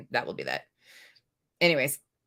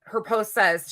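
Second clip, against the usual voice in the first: ordinary delivery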